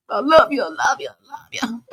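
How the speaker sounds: bold, full-chested I love you; said with your chest. Shown as a demonstration. Telling her partner to say it with their chest, bold declaration. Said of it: "say it with your chest".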